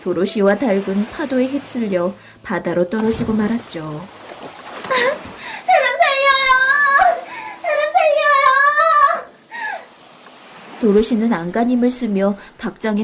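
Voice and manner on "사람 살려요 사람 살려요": cry for help. Acted. Dorothy swept into the sea, crying for help. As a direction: cry out as if swept away by waves.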